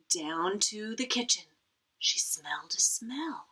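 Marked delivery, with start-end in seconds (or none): 2.0-3.5 whispered suspense; hushed. A narrator's reading. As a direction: breathe it like a secret.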